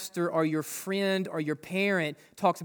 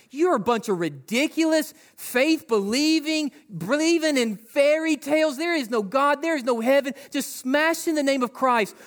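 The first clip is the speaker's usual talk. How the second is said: mock angry attacker; 6 semitones higher than usual. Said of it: "They came mad, like".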